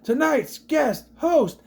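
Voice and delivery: high, stretched-out big announcement; hammed-up grand billing; mock announcer introduction. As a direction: drag out each word like a ring introduction. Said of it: "So he says".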